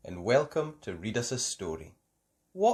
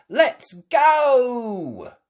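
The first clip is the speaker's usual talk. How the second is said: high, loud cheer; 12 semitones higher, 10 dB louder than usual. A narrator's reading. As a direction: shout it gleefully. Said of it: "cheered Peppa".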